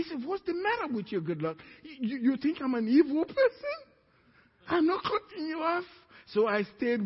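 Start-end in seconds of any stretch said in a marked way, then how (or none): 0.2-5.8 high incredulous boss. Retold or imitated. Boss reassuring him, voice high.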